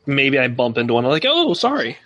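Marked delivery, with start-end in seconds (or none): 1.3-2.0 high-pitched, lightly acted oops; startled apology. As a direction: apologize as if you just bumped into someone. Acts bumping into someone in game.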